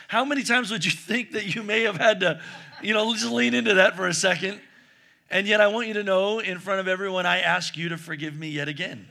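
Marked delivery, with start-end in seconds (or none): 5.3-9.1 sincere apology. Real, not acted. Apologizes to wife before congregation.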